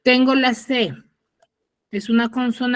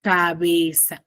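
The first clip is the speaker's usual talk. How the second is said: slow, stretched "Cabeza"; drawn out; 5 semitones lower, slower than usual. Shown as a demonstration. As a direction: say "Cabeza" slowly, every syllable clear. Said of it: "teacher models the answer".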